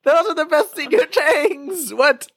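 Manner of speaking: high squeaky disbelief; incredulous. Real, not acted. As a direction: protest in a high shocked voice. High-pitched outraged disbelief at friend.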